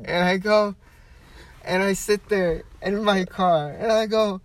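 high, loud, struggling through laughter; laughter-strained storytelling; cracking up. Real, not acted. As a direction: keep telling it while about to burst. Telling punchline, breaking into laughter.

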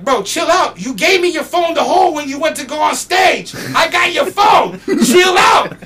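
loud, high retold yelling, building; exasperated shouting. Roadie holding the phone to the mad rapper, exasperated shouting. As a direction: shout it at him, imitating a fed-up roadie.

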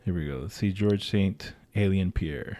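deep announcer voice. Pretend. Mock announcer low voice.

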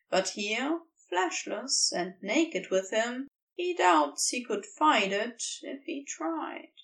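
ordinary talk

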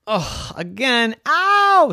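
high yelp of pain, slow then loud; stung cat. Acted. Cat Purdy stung again, high loud ow. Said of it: "said Purdy".

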